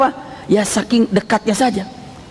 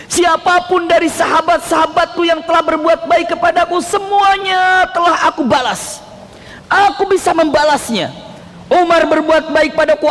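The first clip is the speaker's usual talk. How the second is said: high, fervent sustained declamation; preacher voicing an ardent prayer; impassioned prayer; 8 semitones higher than usual. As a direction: plead to God at a high pitch. Preacher acts Prophet's fervent prayer.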